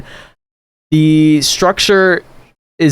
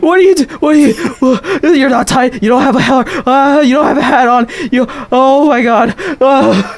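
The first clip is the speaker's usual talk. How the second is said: panicked; 9 semitones higher than usual. Acted. Scared NPC panics at player.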